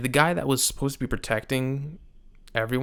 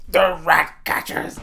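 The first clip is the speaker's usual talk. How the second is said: put-on louder, slow, outraged shout of an old lord; mock furious yell; 6 semitones higher, 7 dB louder, slower than usual. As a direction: yell it like furious Otto, drawn out. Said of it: "Otto's like".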